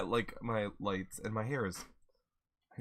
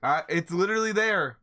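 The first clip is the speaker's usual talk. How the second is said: excited; 7 semitones higher, 7 dB louder than usual. Real, not acted. Playful protest.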